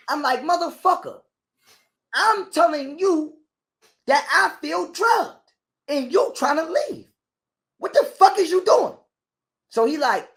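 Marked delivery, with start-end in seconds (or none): 0.4-9.0 high, slow, strained yelling at the bodyguard; panicked outburst, high and slow; retold angry yelling. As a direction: rage and plead at once, pitched high and dragged out. Confronting bodyguard, feels drugged.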